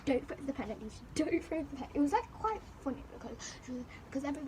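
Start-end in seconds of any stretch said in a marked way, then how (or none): none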